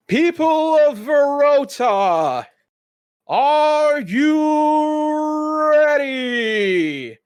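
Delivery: high, very slow hype shout; dwarf ring announcer rallying the crowd; booming announcer shout. Acted. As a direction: stretch it out to pump the crowd.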